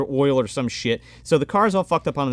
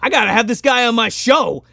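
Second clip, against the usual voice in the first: tag. Retold or imitated. Acting the driver hearing her story.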